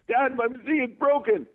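put-on very high little-kid voice; imitated kid's complaint; complaining child. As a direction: mimic a child telling on a broken toy. Acted child complaining to dad.

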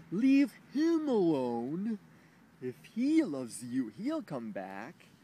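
slow, loud, earnest advice voice; mock wise stranger, drawn out; slow loud mock sermon; imagined bystander. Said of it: "instead of saying".

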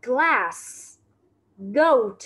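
"Glass." then "Goat." said alone, clear English, for copying; teacher rereads "Glass." and "Goat." for review; model English words. Shown as a demonstration. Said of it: "cô [name] đọc lại nha".

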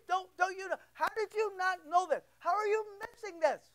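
can't believe they missed it; incredulous Paul. Retold or imitated. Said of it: "the church at Rome and he says".

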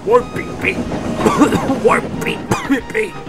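high, loud wheeze broken by coughs; choking but dogged chant; coughing determinedly. A narrator's reading. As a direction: force it out between coughs. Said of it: "James coughed determinedly".

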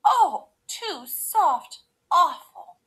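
high, loud, drawn-out groan; groaning complaint. A narrator's reading. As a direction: groan slowly in exaggerated dismay. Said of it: "she groaned".

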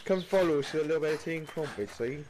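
hammed-up British host inviting folk to tea; a jolly British accent, joking; mock British accent. Mock British accent offering tea and crumpets.

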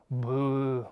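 low, drawn-out moo; low animal boo; beast's moo. A narrator's reading. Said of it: "zei het beest".

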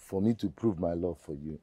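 low, very quiet plea; soft pleading. Acted. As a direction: plead gently, hushed and low. Suitor pleads for a chance.

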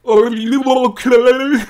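loud, slow, high monster voice. Acted. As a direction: bellow it like a goofy monster. Tuna monster voiced in story.

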